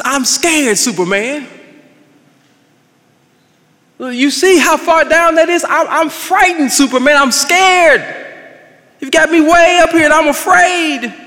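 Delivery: frightened man begging Superman while being carried high above the ground; scared man. Acted. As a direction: play the man clinging on, terrified of the drop.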